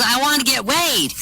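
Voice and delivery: put-on high-pitched girlish voice; eager girl. Kim telling her date what she wants, eager. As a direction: say it like a keen young woman.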